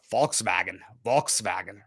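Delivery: "Volkswagen" the German way, F at the start, V in the middle; German pronunciation. Shown as a demonstration. German teaching the American host "Volkswagen", German pronunciation. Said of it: "it's a little harsher".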